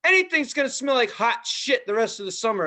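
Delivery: high, loud mock rant about dog mess; ranting phone call. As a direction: imitate the owner ranting on the phone. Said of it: "she's like".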